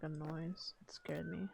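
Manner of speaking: hushed whisper, almost no voice. Real, not acted. Jumpy streamer whispering about a strange noise, hushed. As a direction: breathe it out as if something might hear. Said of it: "sorry, I heard, like".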